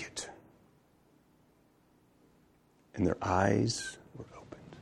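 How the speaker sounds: low, soft reading with long reverent pauses; hushed scripture reading. Real, not acted. Preacher reads of Jesus breaking bread at table, hushed scripture reading. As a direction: read the verse quietly, letting each phrase settle.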